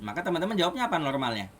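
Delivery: ordinary talk, nothing marked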